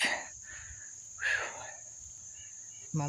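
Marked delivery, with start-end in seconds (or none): 0.0-1.6 long breathless blowing out; heavy exhale. Real, not acted. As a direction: puff out air after the climb. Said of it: "Forgive me for breathing so hard".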